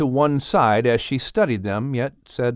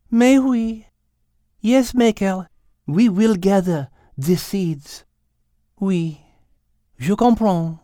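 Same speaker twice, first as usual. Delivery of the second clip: put-on higher French-accented agreement; French cat conceding; 6 semitones higher, slower than usual. Cat agreeing with Michael, gentle French accent. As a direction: accept it graciously, with French lilt.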